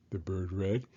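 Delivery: plain reading voice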